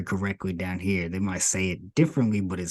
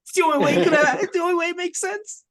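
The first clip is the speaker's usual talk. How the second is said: high-pitched mocking squeal; pleading mock falsetto; mocking impression; 4 dB louder, faster than usual. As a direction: mimic your friend in a silly, very high voice. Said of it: "He's like".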